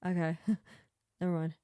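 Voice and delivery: giggly, quick backing down; laughing brush-off. Retold or imitated. Quoting Sammy backing off, laughing. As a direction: laugh it off and drop it. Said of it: "she's just like".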